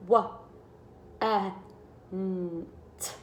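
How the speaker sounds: "Wa, eh, n, t" split into separate sounds; teacher segmenting a word; sounding out. Shown as a demonstration. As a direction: sound out each letter apart. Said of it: "I hear four sounds".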